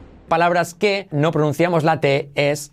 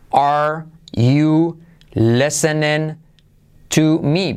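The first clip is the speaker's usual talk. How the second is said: very slow, spaced-out teaching model; slow word-by-word; much slower than usual. Shown as a demonstration. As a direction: separate every word for learners. (muy lento, palabra por palabra)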